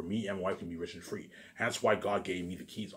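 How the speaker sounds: usual speaking voice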